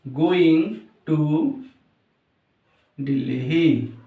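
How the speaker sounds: word by word with long pauses; slow dictation. Shown as a demonstration. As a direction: dictate "going ... to ... Delhi" as if writing. Dictates while writing it out. (रुक-रुक कर, लिखते हुए बोलना)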